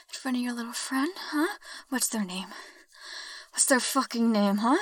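raising the voice in a jealous demand. Acted. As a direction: bark the question at her. Jealous interrogation during roleplay.

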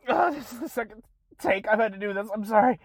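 normal talking voice

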